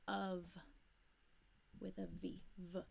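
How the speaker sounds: Of ends in a soft V sound. Shown as a demonstration. Teacher shows how students should say of. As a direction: say Of as short U then V. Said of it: "of sounds like a short U and a V".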